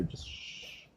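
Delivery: very soft, hushed shushing; soothing shush. Real, not acted. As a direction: gently shush a nervous dog. Soothing a dog while grooming.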